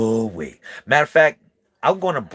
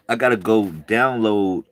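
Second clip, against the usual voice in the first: usual speaking voice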